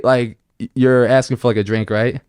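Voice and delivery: ordinary talk, nothing marked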